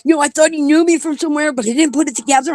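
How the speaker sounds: mock-innocent friend explaining the Facebook chat; girlfriend retelling. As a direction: babble it like the embarrassed friend. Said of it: "She's like".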